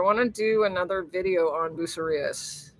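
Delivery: normal talking voice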